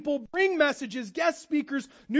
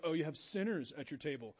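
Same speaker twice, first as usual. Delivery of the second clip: snooty disapproval; 7 semitones lower, 10 dB quieter than usual. Pretend. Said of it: "everyone's like".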